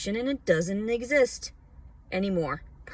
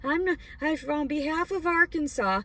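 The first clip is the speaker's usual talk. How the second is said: mocking commenter; 8 semitones higher than usual. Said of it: "for commenting".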